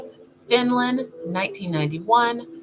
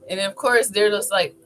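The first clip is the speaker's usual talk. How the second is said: ordinary delivery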